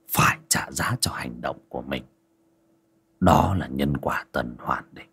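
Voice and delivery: soft, low, almost whispered sigh; a weary old master murmuring quietly; sighing hushed master. A narrator's reading. Ông thầy thở dài.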